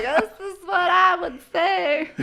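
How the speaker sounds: high, loud, slow with laughter; laughing mock-defiant announcement; hammed-up proclamation. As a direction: declare it boldly, laughing. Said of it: "I'm just like".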